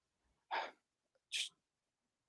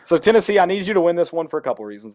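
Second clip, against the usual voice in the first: excited plea; 7 semitones higher, 6 dB louder, faster than usual. Real, not acted. Pleading directly to the team.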